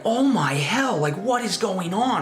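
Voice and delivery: shocked. Retold or imitated. Dogs suddenly start fighting.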